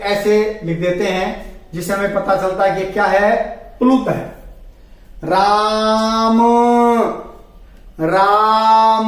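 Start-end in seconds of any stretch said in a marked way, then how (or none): 5.2-9.1 राम called with the vowel held very long, sung out; calling out long. Shown as a demonstration. Teacher showing a far call, राम drawn out like a shout across the field. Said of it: "या फिर किसी को पुकार रहे हैं".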